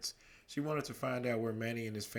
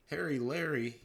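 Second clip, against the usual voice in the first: higher, louder mocking call; teasing cry; 6 semitones higher, 4 dB louder than usual. A narrator's reading. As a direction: call it out to tease. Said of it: "Jared cried".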